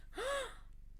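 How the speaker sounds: high sharp intake of breath, hushed; shocked gasp. Retold or imitated. Seeing her friend grab the album, sharp high gasp. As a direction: gasp as if you just saw your prize taken. Spots album in Allison's hands.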